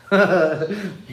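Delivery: a short burst of real laughter. Real, not acted. Streamer reading a chat name, bursting out laughing. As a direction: laugh out loud at the joke.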